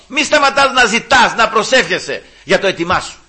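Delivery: preaching full force to the congregation; fiery preaching. Acted. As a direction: urge the faithful never to give up.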